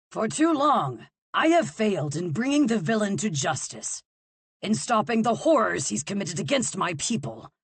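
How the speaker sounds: king denouncing the villain, bellowing; bellowed. Acted. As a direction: bellow it to the whole court. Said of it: "the king bellowed out".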